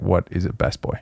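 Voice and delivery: soft, puzzled outsider asking. Retold or imitated. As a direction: mumble it like a confused outsider. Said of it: "anybody outside the industry is like".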